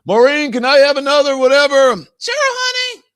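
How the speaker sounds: high, loud mock couple bickering; squeaky put-on voices of couch-bound viewers. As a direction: lampoon lazy TV watchers in high voices.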